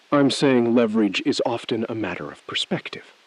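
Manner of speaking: ordinary talk, nothing marked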